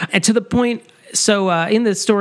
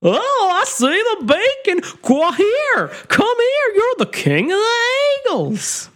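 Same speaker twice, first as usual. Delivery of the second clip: much higher cartoon caller; high silly calling voice; 14 semitones higher, slower than usual. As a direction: call up to the eagles, squeaky. Voicing a beacon watcher calling eagles.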